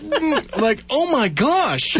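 high, slow appalled reaction; drawn-out, high-pitched comic revulsion; mock nausea. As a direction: act out trying not to be sick. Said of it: "trying to not throw up".

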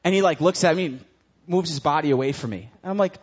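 ordinary talk

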